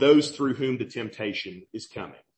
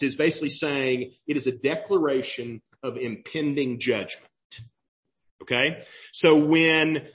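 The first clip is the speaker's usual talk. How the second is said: ordinary talk, nothing marked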